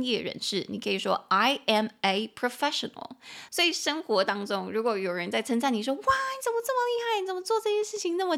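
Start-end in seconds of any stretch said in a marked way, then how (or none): 5.9-8.3 high, gushing admirer; wide-eyed praise from a friend; admiring praise. Pretend. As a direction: gush over how skilled they are. Someone praising you.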